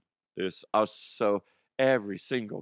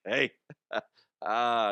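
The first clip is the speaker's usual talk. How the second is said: ordinary talk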